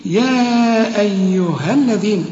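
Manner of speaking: slow recitation with a long held "يا"; measured tajweed delivery of "يا أيها الذين"; recitation demo. Shown as a demonstration. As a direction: stretch "يا", then join "أيها الذين" cleanly. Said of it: "فحنقول".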